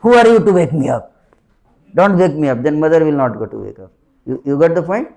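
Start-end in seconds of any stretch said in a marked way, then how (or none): 0.0-2.6 loud, fast mock grumble at being woken; grumpy child. Cranky child woken by mother, loud mock grumble. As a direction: snap back like a cranky child waking. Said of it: "wakes him up and say".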